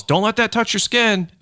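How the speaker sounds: high, drawn-out mock yell; imitated yell. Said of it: "then the guy yells".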